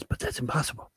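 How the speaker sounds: breathy whispered disbelief; whispered reply. Retold or imitated. Jim answers the doctor in a stunned whisper. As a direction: hush it like a stunned starship captain. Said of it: "And then Jim replies".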